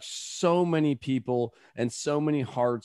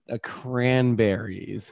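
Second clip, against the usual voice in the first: slow imitated answer; 3 semitones lower, much slower than usual. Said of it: "it's like".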